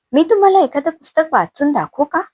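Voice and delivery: bright, eager offer, pitched well up; eager young wife offering to read aloud. A narrator's reading. Daughter-in-law eagerly offers to read to mother-in-law, pitched high. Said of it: "उत्साहाच्या भरात रमा म्हणायची".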